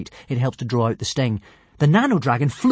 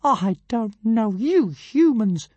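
higher, slow, offended huff; fuming tiny dragon; 10 semitones higher, 3 dB louder, much slower than usual. A narrator's reading. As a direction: fume with wounded pride. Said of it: "fumed Zigarastika".

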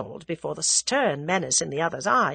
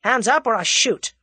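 sudden loud, higher-pitched threat; abruptly forceful American threat; abrupt command; 6 semitones higher, 6 dB louder than usual. A narrator's reading. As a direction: order him sharply at gunpoint. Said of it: "with an abrupt change of manner".

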